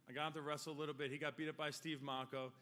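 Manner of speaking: normal talking voice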